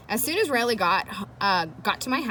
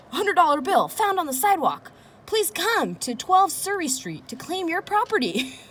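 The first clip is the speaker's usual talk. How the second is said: mock ad voice; 9 semitones higher than usual. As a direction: sell the bait sign with big showy hype. Reading out his bait sign.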